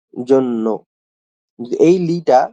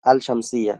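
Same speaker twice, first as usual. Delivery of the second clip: ordinary talk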